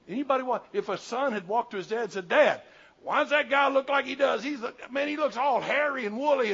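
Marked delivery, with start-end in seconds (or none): 2.3-6.5 put-on higher, louder child's question; a boy's wide-eyed question; curious son. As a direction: voice the puzzled boy asking his dad. Son asking dad in story.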